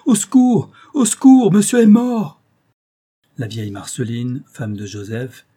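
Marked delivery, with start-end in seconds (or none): 0.1-2.4 high, loud cry for help; losing his head, shouting in alarm; panicked shout. A narrator's reading. Old servant finds his master dead, panicked shout. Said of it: "il cria, perdant la tête".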